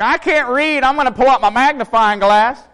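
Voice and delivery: high, loud whiny mock voice; mocking imitation. Wife mimicking her husband at the job, in a high, loud mocking whine. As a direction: ham up a helpless old man. Making fun of me.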